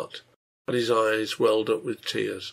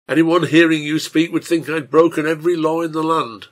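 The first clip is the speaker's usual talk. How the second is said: loud, higher, indignant retort; furious; 5 semitones higher, 8 dB louder than usual. A narrator's reading. Innkeeper protesting his wife's accusation, furious. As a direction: protest angrily with raised voice. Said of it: "Slade said furiously".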